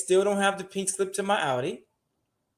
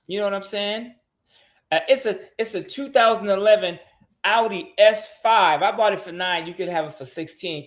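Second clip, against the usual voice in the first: ordinary delivery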